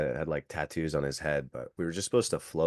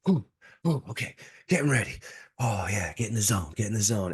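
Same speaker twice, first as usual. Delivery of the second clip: hyping himself up; 3 semitones higher, faster than usual. Retold or imitated. Retells himself hyping up nervously.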